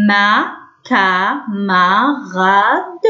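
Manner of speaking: ما كاماراد very slowly, each syllable apart. Shown as a demonstration. Teacher reading to young pupils, syllable by syllable. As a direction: sound out ما كاماراد piece by piece. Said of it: "شفتوا هنا سي مع الأ".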